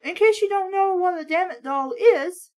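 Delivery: ordinary talk, nothing marked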